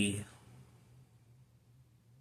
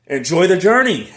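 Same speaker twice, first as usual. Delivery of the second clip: mock advice; 8 semitones higher, 13 dB louder than usual. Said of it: "people say".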